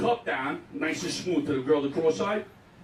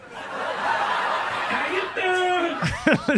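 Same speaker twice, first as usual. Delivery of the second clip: very slow, high New York "How you doing?"; hammed-up New York accent; 10 semitones higher, 4 dB louder, much slower than usual. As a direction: stretch it out, big New York come-on. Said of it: "a whole nother accent he puts on".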